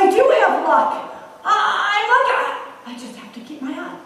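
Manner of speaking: loud, high, laughing delight; excited realization. Acted. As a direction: bubble over with sudden joy. Man excited about luck.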